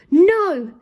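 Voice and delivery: high, loud scream of No; screaming queen. A narrator's reading. Queen being sent to the Tower, screaming. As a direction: shriek it in panic. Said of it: "screamed the queen".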